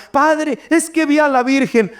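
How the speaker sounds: high-pitched imitation of an excited believer; excited parishioner. Parishioner reporting a vision to the priest, high and excited. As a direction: play a worked-up parishioner, voice lifted high.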